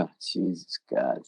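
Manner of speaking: drained mutter under breath; exhausted groan. Retold or imitated. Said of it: "I'm like".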